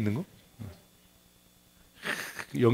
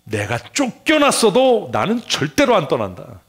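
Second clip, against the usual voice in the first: loud, high, slow declaration; defiant resolve; 7 semitones higher, 8 dB louder than usual. Acted. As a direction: declare it as an immovable vow. Voices Jonah's defiant resolve. (크고 높게 힘주어 다짐하듯)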